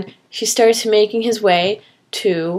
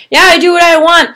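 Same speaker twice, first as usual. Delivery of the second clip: taunt; 7 semitones higher, 7 dB louder, faster than usual. Acted. Suave villain taunting from helicopter.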